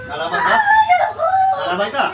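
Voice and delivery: very high, loud challenge; shouted challenge. Real, not acted. Healer asks if the spirit will fight, shouted challenge. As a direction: shout it as a dare.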